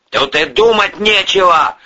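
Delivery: high, loud and insistent. A narrator's reading. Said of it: "повысил голос".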